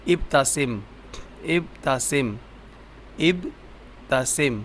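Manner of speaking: ابتسم stretched very slow; slowly drawn out. Shown as a demonstration. Teacher stretching the word for the child, slow. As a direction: draw out ابتسم gently. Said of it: "teacher drills the word".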